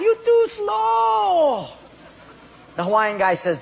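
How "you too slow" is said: high, slow jeering shout; mocking taunt. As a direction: stretch it out to rub it in. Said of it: "that Portuguese guy laughed".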